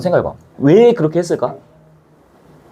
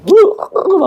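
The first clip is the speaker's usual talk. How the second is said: imitated wailing; 13 semitones higher, much slower than usual. Teacher acting a grieving father, imitating wailing. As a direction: mimic a kneeling mourner wailing. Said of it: "하면서 막 무릎 꿇고".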